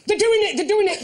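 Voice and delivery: excited. Retold or imitated. Mimicking excited pigeon watcher.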